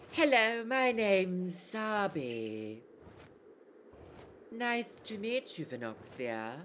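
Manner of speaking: lightly put-on coy, sultry voice. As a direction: draw the introduction out slowly. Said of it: "She's very sultry".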